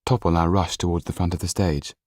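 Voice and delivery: usual speaking voice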